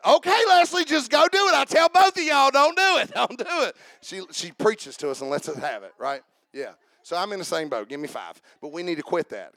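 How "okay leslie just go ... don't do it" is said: raised, louder mock scolding; coworker's scolding. She preaches to us and lets us have it, mock scolding. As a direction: imitate her fussing, high and loud. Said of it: "She said".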